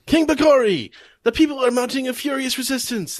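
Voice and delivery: high, loud alarmed report; yelling. Retold or imitated. Soldier warning King Bakori, yelled high and loud. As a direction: shout the bad news up to the king. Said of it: "people are yelling at him".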